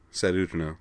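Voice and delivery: usual speaking voice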